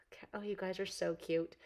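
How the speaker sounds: soft whispered fond reaction. Real, not acted. Reacting to viewers' comments, fond whisper. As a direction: hush it out fondly.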